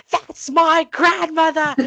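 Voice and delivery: high, loud, drawn-out retold cry; excited shout. Child spotting grandmother round the corner, excited shout.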